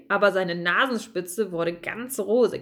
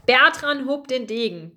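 usual speaking voice

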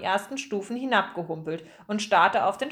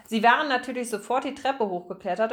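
plain reading voice

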